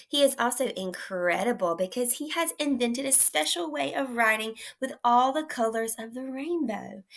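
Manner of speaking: normal talking voice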